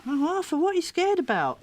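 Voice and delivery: high, cooing concern; high-voiced sympathetic coaxing; concerned questioning. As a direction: ask a child gently, pitched very high. Asking scared child through hand.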